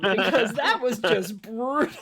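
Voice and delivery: loud amused exclaim. Real, not acted. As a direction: laugh it out loud. GM laughing at the burn.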